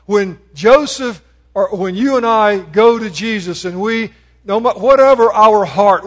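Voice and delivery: loud, driving sermon delivery; preaching. Acted. Preacher urging listeners to call on Jesus, loud and driving.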